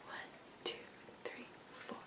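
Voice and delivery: whispered count under the song. Shown as a demonstration. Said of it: "sense of the spacing".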